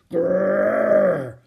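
loud, drawn-out animal growl; terrible growl. A narrator's reading. Mother jaguar warns the children, terrible growl. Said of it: "There was a terrible growl".

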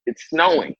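high-pitched retold refusal; half-hysterical objection; mock hysterical. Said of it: "kinda hysterical".

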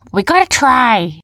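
high, loud story heroine; urging character voice. A narrator's reading. Heroine answering a doubter, loud, high and urging. Jumba urges the others.

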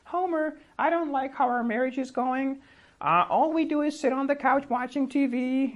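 whiny high cartoon wife complaining to her husband; Marge Simpson. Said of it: "Marge, the wife, asks Homer".

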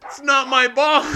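high, loud, cracking up mid-line; teasing the angry cop while laughing; laughing through it. Retold or imitated. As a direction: giggle out the excuse. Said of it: "And I was like".

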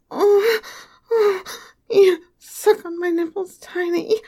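breathless escalating moans; moaning, building. Acted. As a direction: let the moans build and break. Building toward climax, moaning.

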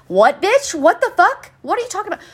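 incredulous, rising in outrage; outraged disbelief. Real, not acted. Outraged at customer's remark.

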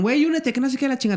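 friends urging. Retold or imitated. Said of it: "Me dijeron".

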